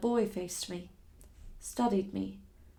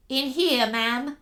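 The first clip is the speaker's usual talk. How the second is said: high squeaky voice; 3 semitones higher, 5 dB louder, slower than usual. Acted. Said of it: "high and squeaky out of the shadows".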